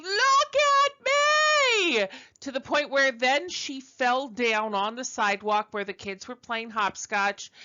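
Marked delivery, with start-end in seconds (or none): 0.0-2.1 high, drawn-out scream, much higher and louder; retold scream. Little girl in a big dress screaming for attention, high drawn-out scream. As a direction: wail it out like a child desperate to be seen. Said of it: "finally she was screaming".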